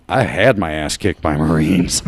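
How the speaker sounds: emphatic storyteller's reply; retold comeback. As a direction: answer the threat emphatically, for laughs. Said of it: "And I'm like".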